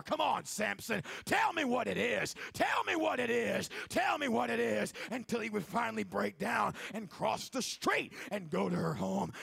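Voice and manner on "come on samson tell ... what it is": louder, higher retold yell from a doorway. Delilah yelling at Samson across the street, loud. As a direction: call it across the street, demanding. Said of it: "she would yell from her front door".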